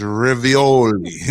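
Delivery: "Ravioli" drawn out, first vowel reduced to "rih"; imitated pronunciation. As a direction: playfully copy her "rih-vee-OH-lee" for "Ravioli". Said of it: "I love the way she says".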